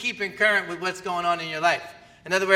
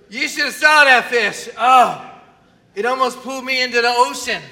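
mock boasting fisherman, loud; boastful fish story; 6 semitones higher, 10 dB louder than usual. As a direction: shout it like a proud angler.

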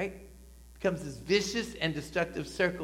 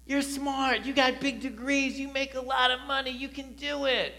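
high, tempting voice of sin; sin whispering flattery, pitched up; 9 semitones higher, 4 dB louder than usual. Acted. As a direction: coax like a sly tempter. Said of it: "Sin says".

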